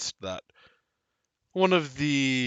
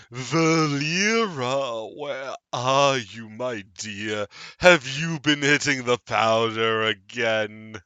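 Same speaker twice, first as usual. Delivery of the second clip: slow, drawn-out creepy call; eerie teacher calling for Valera; Tattered Man calling; 3 semitones higher, 5 dB louder, slower than usual. Acted. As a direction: call out sweetly and menacingly. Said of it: "The man calls out again".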